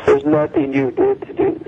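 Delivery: usual speaking voice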